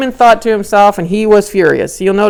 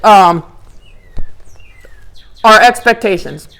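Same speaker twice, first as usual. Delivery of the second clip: ordinary delivery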